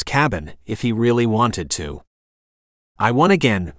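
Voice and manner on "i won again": ordinary delivery, nothing marked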